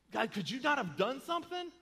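high, loud imitated complaint to God; exasperated plea. Believer asking God why He didn't act, exasperated plea. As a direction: challenge God in acted frustration. Voices a questioner challenging God.

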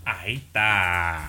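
grave y lenta de hombre; voz de hombre. Acted. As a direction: engrosa la voz y arrastra. Voz de hombre otra vez. (voz de hombre)

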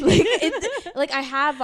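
high laughing words. Real, not acted. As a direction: talk through a burst of laughter. Laughing while explaining.

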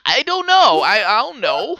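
loud, high-pitched hammed-up wail; mock crying. Student pleads ignorance to the dean, mock crying. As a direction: stammer it in a squealing fake sob. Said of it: "I'm starting to cry".

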